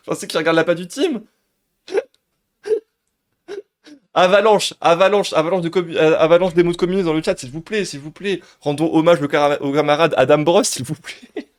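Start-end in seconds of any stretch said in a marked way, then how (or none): 4.1-11.5 loud, high-pitched rally to the chat; excited rallying call. Real, not acted. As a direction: call out urgently for everyone to join in. Rallying the live chat to spam emotes.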